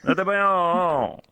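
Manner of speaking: slow, high mock anime shout; anime hero catchphrase. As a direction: stretch datebayo out like Naruto. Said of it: "I'm an anime protagonist".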